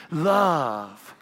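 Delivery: lovingly swept single word; swooping calligraphy love. Pretend. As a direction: draw out "Love" like a flourish of fancy script. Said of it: "We write in these big swooping".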